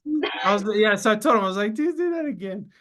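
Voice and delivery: high, amazed mock demand; hammed-up disbelief, voice jumping way up; retelling a shocked demand for a retest, pitched high; excited disbelief. Retells disbelief at normal reading.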